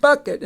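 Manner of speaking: bucket punched high and loud; teacher stressing the tricky word; loud clipped demo. Shown as a demonstration. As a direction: call out bucket sharply. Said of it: "tricky one".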